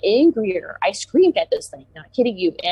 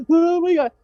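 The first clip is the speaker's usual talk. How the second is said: high, breathless oh my God; frightened gasp; 10 semitones higher, 3 dB louder than usual. Retold or imitated. Fleeing into the house, high frightened exclamation. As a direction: blurt it out, scared stiff. Running back inside, shaken.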